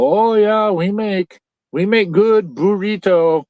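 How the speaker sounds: bad Mexican accent. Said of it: "I'm doing a bad Mexican accent".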